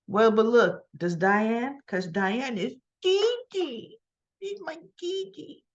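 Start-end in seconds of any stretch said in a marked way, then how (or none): 3.0-5.6 high, soft, drawn-out coo; playful cooing. Pretend. Speaker playfully claims Diane as Gigi, cooing.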